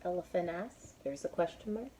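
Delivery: usual speaking voice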